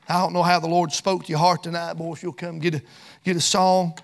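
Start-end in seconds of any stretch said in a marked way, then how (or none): none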